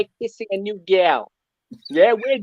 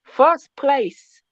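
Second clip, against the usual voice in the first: put-on Jamaican accent, First pushed up higher; Jamaican accent, insisting; 6 semitones higher than usual. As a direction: repeat it in a Jamaican lilt, more insistent and higher. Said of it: "You're getting that Jamaican accent out of me".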